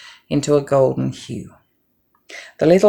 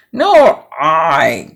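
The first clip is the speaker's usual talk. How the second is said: grunted; 7 dB louder than usual. Acted. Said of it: "grunted the fat little pig".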